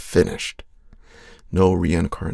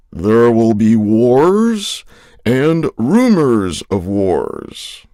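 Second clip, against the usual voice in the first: slow, loud scripture declamation; prophetic proclamation; 6 dB louder than usual. Acted. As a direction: proclaim the prophecy slowly and loudly. Quotes end-times verse as prophecy.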